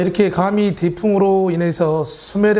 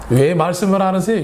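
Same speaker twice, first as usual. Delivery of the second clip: normal talking voice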